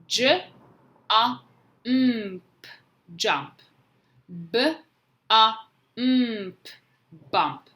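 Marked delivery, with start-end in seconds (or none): 0.1-2.7 J, A, M, P as separate, raised letter sounds; sounding out letters. Shown as a demonstration. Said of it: "say the letters as you're writing them". 4.5-6.7 B, A, M, P as separate letter sounds; sounding out letters. Shown as a demonstration. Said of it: "say the letters as you're writing".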